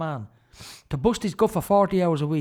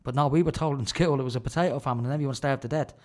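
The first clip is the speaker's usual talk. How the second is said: ordinary talk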